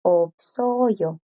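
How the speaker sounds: "Opsoyo" with the S clearly sounded before the vowel; S audible. Shown as a demonstration. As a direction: let the S ring out in "Opsoyo". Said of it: "La S suena sin problema". ("Opsoyo" con la S bien pronunciada ante la vocal)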